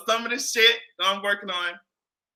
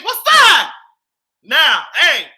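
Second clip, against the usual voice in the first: loud greeting; 8 semitones higher, 7 dB louder, slower than usual. Real, not acted.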